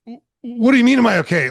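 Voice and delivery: high, loud disbelieving question; a thrown man firing back confused; baffled retort. Retold or imitated.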